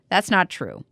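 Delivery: ordinary talk, nothing marked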